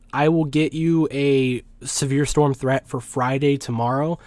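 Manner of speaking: usual speaking voice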